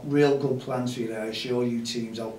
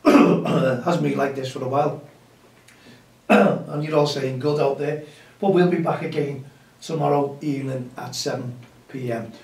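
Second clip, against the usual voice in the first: hoarse, strained voice with throat clearing; losing voice; 3 semitones higher, 8 dB louder than usual. Real, not acted. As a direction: push through a voice that's going hoarse. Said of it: "I think I'm losing my voice".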